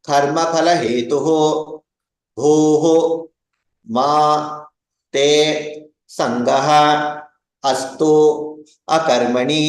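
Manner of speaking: each word split off with long pauses. Shown as a demonstration. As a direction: separate every word with a pause. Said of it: "Third way".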